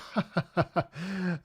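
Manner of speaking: real burst of laughter, falling away; helpless laugh. Real, not acted. Streamer realising the greeting was a swear, laughing. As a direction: laugh helplessly at your own slip.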